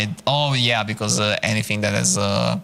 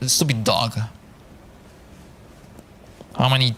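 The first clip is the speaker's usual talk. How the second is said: ordinary delivery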